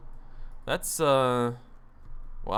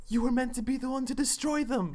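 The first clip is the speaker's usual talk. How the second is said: lightly voiced Obi-Wan, higher; solemn Jedi mentor line, pitched up; Obi-Wan impression; 13 semitones higher than usual. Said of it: "talking like Obi-Wan".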